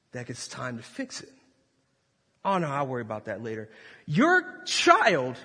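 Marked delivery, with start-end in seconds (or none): 2.4-3.7 quick, soft, low careless reply; dismissive brush-off. Pretend. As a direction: wave it away fast.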